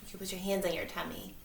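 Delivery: calm them down gently; kindergarten teacher voice. Acted. Leads breathing exercise.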